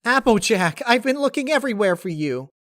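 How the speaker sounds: squeaky excited cartoon pony; Pinkie Pie. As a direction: chirp it out like an eager, bouncy pony. Narrator voices Pinkie Pie.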